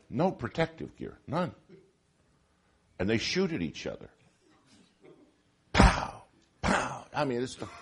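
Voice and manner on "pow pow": punchy imitated shot "pow", loud first; mock gunshot. Preacher imitating paintball guns firing, loud. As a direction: pop the sound like a paintball gun firing.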